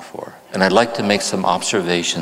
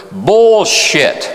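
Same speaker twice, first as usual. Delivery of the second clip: full-throated shout, much higher and louder; loud yell; 12 semitones higher, 8 dB louder than usual. Retold or imitated. The room yelling at the newcomer's answer, a loud shout. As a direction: bellow it like a whole room calling him out. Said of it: "yell as loud as they could".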